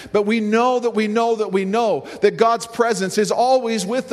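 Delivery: preaching at full force. Acted. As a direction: lift it, rally the room.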